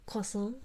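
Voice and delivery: Croissant said "kwa-sa" the French way; French-style croissant. Shown as a demonstration. Streamer answering chat how she says croissant. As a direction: glide through Croissant like French, no r. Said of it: "How do you say croissant?".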